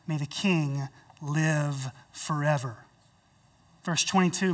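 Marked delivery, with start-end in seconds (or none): 0.1-2.8 low, soft, slow respectful blessing; bow your voice in humble honor; humble and honorable. Acted. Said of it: "humbly says, and with honor".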